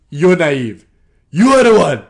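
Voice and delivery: ad-libbed comeback to the coworker, loud and mock-furious; mock angry yell. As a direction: shout it back like a petty quarrel. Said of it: "I'm ad-libbing".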